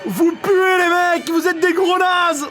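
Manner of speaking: high, loud, slow taunting jeer; jeering crowd voice. Pretend. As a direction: jeer at them like the angry crowd. Commentator voicing a jeering crowd.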